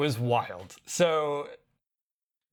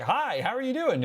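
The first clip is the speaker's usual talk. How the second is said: greeting pitched way up, lively; bright high greeting; 10 semitones higher than usual. Shown as a demonstration. Describing the translator to co-host, chirpy high greeting. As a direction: lift the voice high on the hi. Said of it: "it even had the inflection of tone".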